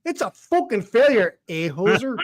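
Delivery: a hint of a mock Canadian accent, eh at the end; lightly put-on Canadian hoser voice; Scottish Canadian accent. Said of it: "that's kinda like Scottish Canadian".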